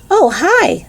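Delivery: high bright greeting; brightly. A narrator's reading. Lisa greeting Mac brightly, high and cheerful. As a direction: chirp it out cheerfully. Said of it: "said Lisa brightly".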